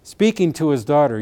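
ordinary talk

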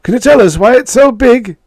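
very high, loud question; reporter calling out. A narrator's reading. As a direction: shout it from a press pack. Reporters call questions to Jake.

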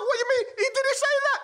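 mock-indignant denial, pitched high; indignant prisoner. Prisoner denying the preacher's claim, high and indignant.

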